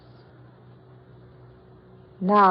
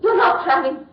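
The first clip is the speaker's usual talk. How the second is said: horrified protest; 5 semitones higher, 7 dB louder than usual. Acted.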